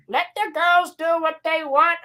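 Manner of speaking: a hint of a crone butting in; old alien witch. Old alien interrupts the argument, lightly voiced witch. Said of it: "old, terribly looking C G I alien".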